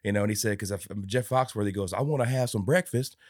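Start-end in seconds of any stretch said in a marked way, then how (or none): 1.9-3.2 lightly imitated country accent; mock country accent, pitched up. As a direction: put on a touch of country accent, higher. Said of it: "doing his country accent".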